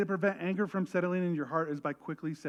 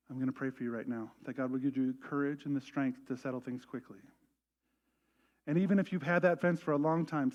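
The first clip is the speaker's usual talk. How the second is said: prayer voice; 3 semitones lower than usual. Real, not acted. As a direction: lead them into prayer. Pastoral prayer lead-in.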